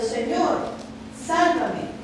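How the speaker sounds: crying out. Acted. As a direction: cry "Señor, sálvame" out like a man sinking in the waves. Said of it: "gritó, diciendo".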